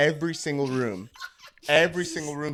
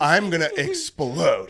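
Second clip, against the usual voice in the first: slow, louder, laughing; laughing mock exasperation; 3 semitones higher, 6 dB louder, much slower than usual. Describing being overwhelmed, laughing exasperation. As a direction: stretch it out, half laughing.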